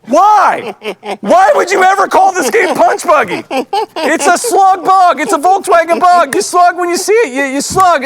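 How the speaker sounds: loud, high, exasperated ranting. Real, not acted. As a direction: vent your outrage at the wrong name. Ranting about punch buggy name.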